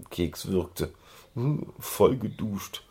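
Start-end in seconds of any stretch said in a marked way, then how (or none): 1.3-2.8 soft, slow, smug; grinning. A narrator's reading. Pony grinning at the soaked man, soft and slow. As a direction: say it with a grin. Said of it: "Schoko grinste".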